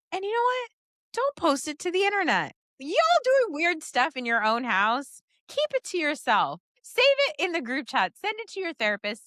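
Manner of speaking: high-pitched exasperated rant; fed-up lecture to oversharing parents; heated rant. Real, not acted. Ranting advice to oversharing parents.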